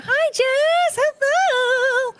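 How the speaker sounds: very high, sing-song hello; squeaky delighted greeting. Real, not acted. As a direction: chirp a hello to a friend. Greeting a friend warmly.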